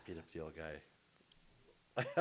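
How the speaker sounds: drawn-out, low and quiet confession; slow deadpan inmate. Acted. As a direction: drag it out softly as a convict's answer. Repeats inmate's answer for the joke.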